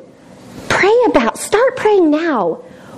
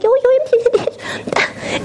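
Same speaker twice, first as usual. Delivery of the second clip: high, slow, amazed voice; mock surprised mom; 10 semitones higher, slower than usual. Mom amazed at son emptying dishwasher, high and slow. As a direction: act stunned but play it cool.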